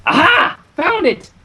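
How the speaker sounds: high, eager triumphant cry; excited find. Acted. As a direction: crow over the found book. Finding the lost book.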